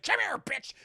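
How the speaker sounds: high, loud hostage-taker's order; aggressive mock shout. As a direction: yell it while grabbing someone. Retold grab of a hostage.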